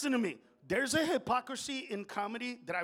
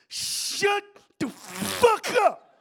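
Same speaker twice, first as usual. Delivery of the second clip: very slow, high, straining shout; 13 semitones higher, 11 dB louder, much slower than usual. Acted. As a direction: force each word out loudly with huge effort. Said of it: "he goes".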